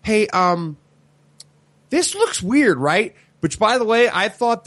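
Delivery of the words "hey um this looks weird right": innocent question. Retold or imitated. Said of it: "then he starts saying".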